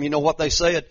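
ordinary delivery

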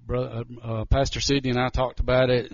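ordinary talk, nothing marked

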